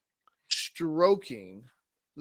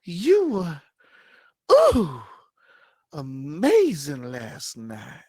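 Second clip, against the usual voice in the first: much higher, louder breathy gush, slowing at the end; Sally swooning; 5 semitones higher, 8 dB louder, slower than usual. Acted. Sally gushing to Omar about last night, high and loud. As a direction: gush like a smitten girl. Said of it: "Sally like".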